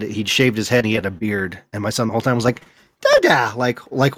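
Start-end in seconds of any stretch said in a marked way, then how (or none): 3.0-3.5 put-on high excited baby call; toddler calling Dada.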